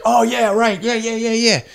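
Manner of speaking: high-pitched imitation of a flustered yes; hasty agreement. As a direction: act out a friend suddenly remembering. Retold friend suddenly remembering the interview.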